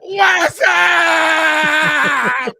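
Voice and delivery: long, high, full-volume holler; catchphrase yell. Pretend. Guest greeting the show with a screamed catchphrase. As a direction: scream it and hold the vowel.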